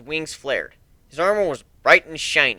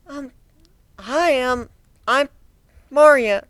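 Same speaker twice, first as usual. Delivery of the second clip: nervous; 12 semitones higher, slower than usual. Acted. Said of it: "She dug her claw into the ground nervously".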